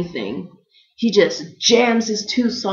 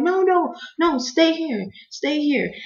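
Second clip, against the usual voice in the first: high-pitched, insistent command; mock-alarmed "stay put"; imitated urgent refusal; 10 semitones higher, slower than usual. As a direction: mimic staff insisting, high and firm. Staff stopping the patient leaving.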